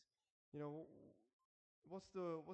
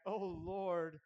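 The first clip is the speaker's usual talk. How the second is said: loud, desperate cry to God; anguished appeal to heaven; anguished calling out; 6 dB louder than usual. Acted. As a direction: call out as if at the end of all hope. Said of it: "they call out to the Lord".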